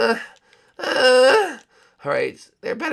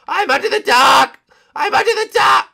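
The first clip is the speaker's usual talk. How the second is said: loud higher call from below; 11 semitones higher, 8 dB louder than usual. Acted. Stuck under the dock, shouting to friends.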